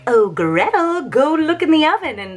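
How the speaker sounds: high, singsong witch request; wheedling witch. Retold or imitated. Witch tricking Gretel toward the oven, high wheedling. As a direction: lure her with a put-on sweet witch voice. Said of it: "The witch is like".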